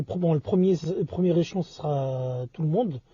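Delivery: usual speaking voice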